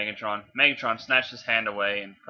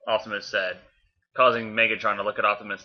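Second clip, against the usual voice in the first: usual speaking voice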